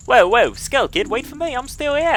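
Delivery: high-pitched put-on English accent. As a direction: yelp indignantly in a mock English accent. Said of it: "I wanna do the English accent".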